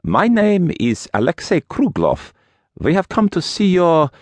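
put-on high Russian-accented introduction; strong Russian accent, trailing off for a word. A narrator's reading. Russian visitor introduces himself to the priest, strong Russian accent. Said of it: "he had a strong accent".

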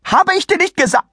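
very high, cut-off tirade; shrieky old lady; shrill scolding. Acted. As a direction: berate him until interrupted.

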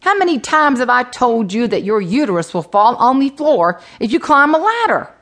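high, rising exasperated scolding; scolding mama. Acted. Mama on the phone to her injured daughter, scolding. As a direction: scold your child in mock outrage.

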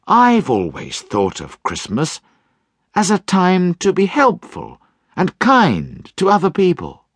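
high, warm, rising to a hopeful cry; earnest young nephew. Acted. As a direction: speak from the heart for Christmas. Nephew's heartfelt speech.

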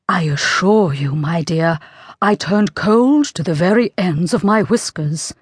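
hushed, slightly slow, fearful voice; frightened whisper. A narrator's reading. Shaken King confides in Queen, frightened whisper. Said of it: "talking together in a frightened whisper so low".

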